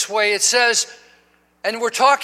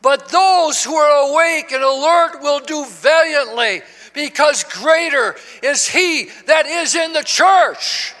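sustained warning building to a shouted climax; impassioned preaching; 4 semitones higher, 4 dB louder, slower than usual. Acted. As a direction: drive it home to the congregation, rising to a shout. Preaching at full force.

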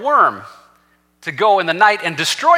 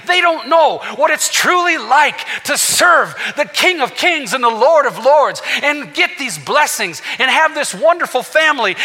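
high, loud, slowed preaching shout; preaching at full force; 8 semitones higher, 3 dB louder than usual. Real, not acted. Preacher crying out to the congregation about God's blessings, loud and high. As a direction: thunder it out to the congregation.

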